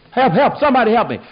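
high, loud, fast mock panic; panicked cry for help. Terrified onlooker begs for rescue, panicked cry. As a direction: yell for rescue in acted alarm.

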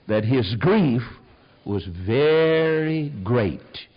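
slow, weighty Bible reading; solemn reading of Job to the congregation; solemn scripture reading. Acted. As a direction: read it slowly and gravely.

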